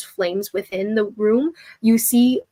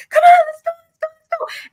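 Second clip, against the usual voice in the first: very high, loud urgent yell; frantic shout over the panic; crowd shouting; 7 dB louder than usual. Retold or imitated. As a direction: shout to hurry the crowd along. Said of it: "People are shouting and like".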